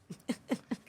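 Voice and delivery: short, high, quiet chuckle; soft chuckle. Real, not acted. Podcast host laughs after the guest's tip, soft chuckle. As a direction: let out a small high laugh.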